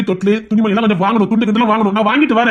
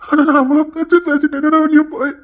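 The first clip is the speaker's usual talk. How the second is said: tearful remorse; 4 semitones higher than usual. Acted. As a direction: break down begging forgiveness. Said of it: "நீ அழாத".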